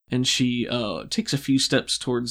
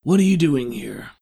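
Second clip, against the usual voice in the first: a sharp, louder demand; louder villain demand; 3 semitones higher, 7 dB louder than usual. Acted. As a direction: challenge the intruders. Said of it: "he scratches at his chin".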